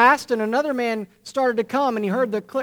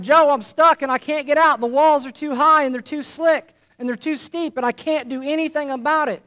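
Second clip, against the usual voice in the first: desperate pleading; 7 semitones higher, 4 dB louder than usual. Acted. Trapped friend pleading to Joe.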